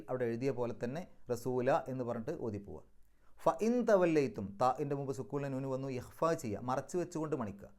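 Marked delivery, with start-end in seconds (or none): 3.4-4.4 recitation. Shown as a demonstration. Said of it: "recites verse for class".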